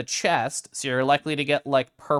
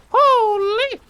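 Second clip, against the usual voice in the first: even higher, louder squeal; amazed shriek; 8 dB louder than usual. Real, not acted. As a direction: scream it in delight. Amazed at loot.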